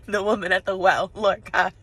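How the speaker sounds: loud bursts of helpless laughter; really cracking up. Real, not acted. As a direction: crack up between the words. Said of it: "I'm just cracking up".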